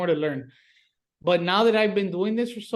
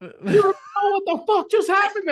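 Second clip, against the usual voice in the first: laugh-crying disbelief; 11 semitones higher, 5 dB louder than usual. Real, not acted.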